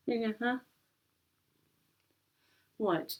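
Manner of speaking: ordinary talk, nothing marked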